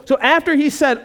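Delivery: ordinary talk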